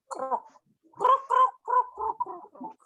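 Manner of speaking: high croaky frog calls; mock frog croaks. A narrator's reading. Storyteller croaking like a frog for the child.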